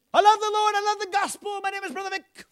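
high-pitched, shouted preacher impression; Brother Vic. Pastor mimicking the missionary preaching the gospel, high shouting voice. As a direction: proclaim it at the top of your voice. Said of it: "That's the way he talked".

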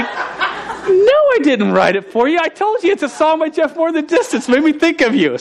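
high-pitched exasperated correction; hammed-up high voice, setting the record straight; flustered mock protest. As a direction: retell it as a squeaky, defensive explanation. Flustered retort to bawling girlfriend, retold.

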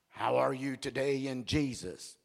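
low, mock-pious greeting; put-on super-spiritual voice; fruity churchgoer. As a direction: greet them in a low, overly holy voice. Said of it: "Stop being so fruity".

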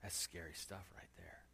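hushed aside to the congregation; whisper. Real, not acted. Preacher whispering an aside to the congregation. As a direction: drop to a breathy whisper.

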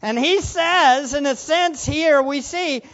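ordinary talk

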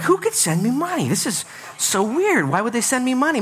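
high, baffled wondering; puzzled. Retold or imitated. Pastor replaying his confusion over the envelope, high and puzzled. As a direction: muse aloud, confused and curious.

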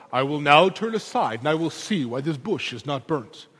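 lightly put-on epic film prophet; a hint of grand old movie hero; Charlton Heston. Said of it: "Maybe a Charlton Heston kind of thing".

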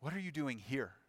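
soft question landing on "here"; hushed, searching, "here" last and weighted; stress on here. Shown as a demonstration. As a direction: quietly put the weight on "here". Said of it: "put the emphasis towards the end".

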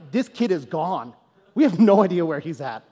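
ordinary talk

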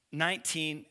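ordinary delivery

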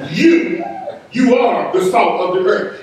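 "You" set apart and lifted, then "you are the salt of the earth"; pointing "You," lifted and held apart; emphatic you again. Shown as a demonstration. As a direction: replay the pointed "You" for the crowd. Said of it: "Rewind, press play".

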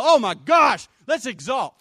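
loud, high outburst; exuberant shout. Acted. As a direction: cry out in overwhelmed excitement. Urging joyful exultation.

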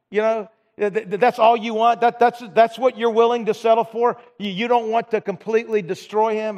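mock-indignant rebuke, that's all you want; angry prophet. Prophet scolding the king for settling, retold angry rebuke. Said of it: "I think the prophet was angry, saying".

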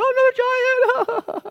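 very high exclaimed oh; feigned shock at yet another problem; mock dismay. Worried believer facing another giant, mock dismay.

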